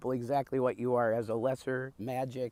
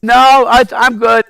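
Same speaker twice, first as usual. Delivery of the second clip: very loud, high shouted reply; loud shouting; 10 semitones higher, 21 dB louder than usual. Real, not acted. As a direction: shout it out, still worked up. Yelling after plane passes.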